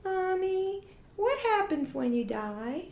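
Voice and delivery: put-on high, small child asking, slow; child asking mom from the back seat, high little voice; little child's voice. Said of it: "I hear this little voice go".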